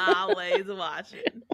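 high, slow laughing Roz impression. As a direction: stretch it out, laughing. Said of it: "Roz from Monsters, Inc".

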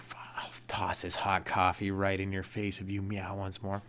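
whispered threat to the cat. Retold or imitated. Owner threatening the cat in a whisper. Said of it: "I was like".

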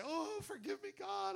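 lightly hammed-up pitiful prayer; mock weeping plea. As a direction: whimper it like a defeated believer. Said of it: "you just say".

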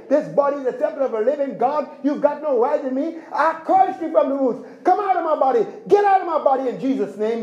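laying a curse. Retold or imitated. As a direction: curse it out with authority, firm and strong. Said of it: "you gotta have that kind of attitude".

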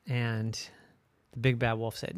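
usual speaking voice

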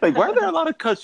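high, fast, laughing; laughing mock question. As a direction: squeal it like a confused viewer.

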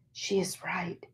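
breathy unvoiced murmur; whisper. A narrator's reading. Spirit quietly agreeing with Virginia, whispering. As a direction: barely breathe the words. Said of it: "I whispered".

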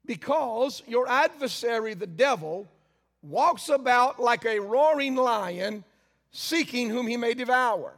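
slow, solemn scripture reading; first scripture reading. Acted. Preacher reads the verse to the congregation, solemn. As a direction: read it through before the emphasized take. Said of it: "I'm gonna read it again with a, uh, emphasis".

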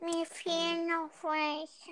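ordinary talk

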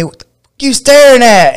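high, loud mocking of an angry guy; hostile challenge. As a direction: sneer it out like a picking-a-fight dude. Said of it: "He looked at me".

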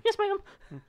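put-on high, small obedient kid voice; meek kid voice. Kid answering grandma, high and meek. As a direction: answer like a scolded child.

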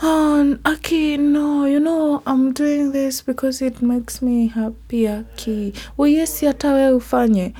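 playing someone explaining herself; mock defensive excuse. A friend mimicking someone justifying herself, mock pleading. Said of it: "then have to say".

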